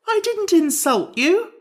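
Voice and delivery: high, loud, drawn-out protest; indignant protest. A narrator's reading. Jack protesting to the Scarecrow, indignant. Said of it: "protested Jack indignantly".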